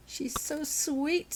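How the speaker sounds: put-on high, slow little-girl coo; small girl cooing at a purring kitten.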